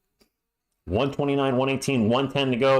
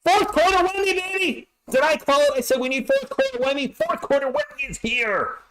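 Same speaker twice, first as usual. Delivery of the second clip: excited yelling; 12 semitones higher than usual. Real, not acted. Streamer celebrating his prediction to chat, excited yelling. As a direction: crow that you called it. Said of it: "I gotta try not to yell".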